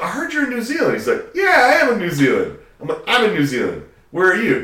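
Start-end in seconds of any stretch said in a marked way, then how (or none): 0.0-0.9 high-pitched, animated phone-call quote; excited retold greeting. Calling an old friend in Auckland, excited. 1.3-2.6 high, loud imitation of an excited friend; excited retold reply. Long-lost friend answering the call, excited. 3.1-4.6 raised, louder voice, eagerly re-enacted; excited retold reply. Calling an old friend in New Zealand, excited.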